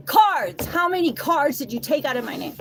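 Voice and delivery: sudden loud high yell of Cards!; retold shout at the girlfriend. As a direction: bellow the accusation, high and loud. Voicing his shouting.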